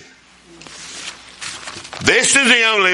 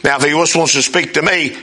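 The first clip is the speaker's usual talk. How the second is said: usual speaking voice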